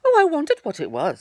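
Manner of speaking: high, loud bouncy tiger voice; big bouncy tiger; Tigger voice. A narrator's reading. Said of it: "said Tigger".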